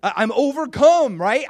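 high, loud cry of amazement; overcome. Acted. Showing how David feels, loudly overcome. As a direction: burst out as if overcome by it all. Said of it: "this is how David feels".

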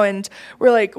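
normal talking voice

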